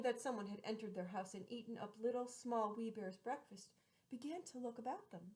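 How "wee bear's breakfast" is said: ordinary delivery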